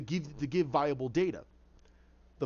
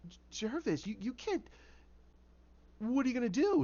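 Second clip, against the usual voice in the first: worried concern; 7 semitones higher than usual. Acted. Alice learning that Jervis is broke, worried. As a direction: falter gently, pitched up with concern. Alice reacting to bad news.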